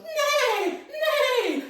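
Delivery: loud high neigh; whinny like a galloping horse. Shown as a demonstration. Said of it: "he warns the sheep".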